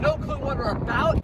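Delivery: ordinary delivery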